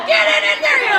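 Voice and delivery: loud, high bossy command; high-pitched mock yell; mom's bossy yell. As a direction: bark it like an embarrassing mom. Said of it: "She was so embarrassing".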